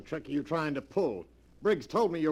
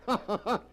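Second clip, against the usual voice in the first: amused laugh; 8 semitones higher than usual. Acted. Scoffing at selling his face.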